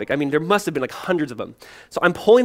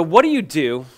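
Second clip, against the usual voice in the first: usual speaking voice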